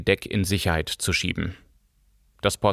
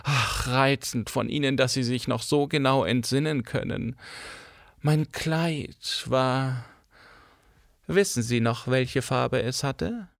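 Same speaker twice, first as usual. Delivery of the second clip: coy actress; 3 semitones higher, 5 dB quieter than usual. Acted. Cora fishing for compliments.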